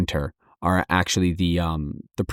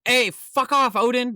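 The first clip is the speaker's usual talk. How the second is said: yelling out; slower than usual. Retold or imitated.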